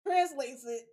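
ordinary talk, nothing marked

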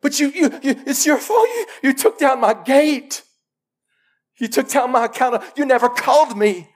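high, whiny voice of the pig shifting blame; whining pig. Acted. Pig accusing its keeper, high and whiny. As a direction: play the pig crying it's your fault.

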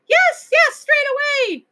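very high creature shout; crowd shouting. A narrator's reading. The whole household shouting to the king, high and loud. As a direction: yell it all together, thrilled. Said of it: "everyone shouted at once".

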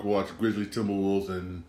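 ordinary talk, nothing marked

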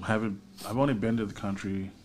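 ordinary delivery

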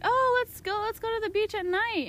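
high-pitched retold enthusiasm; eager suggestion. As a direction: imitate friends eagerly proposing an outing. Acting group's eager suggestion.

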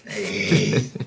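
ordinary delivery

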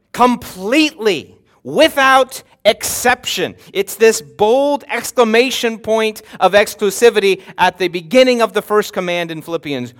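normal talking voice

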